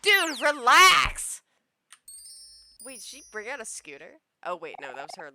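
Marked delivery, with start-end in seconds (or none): 0.0-1.4 slow, loud outburst; exasperated shout. Acted. Hero telling the princess off, slow loud shout. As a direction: yell it, drawing the words out.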